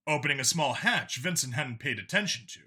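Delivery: ordinary delivery, nothing marked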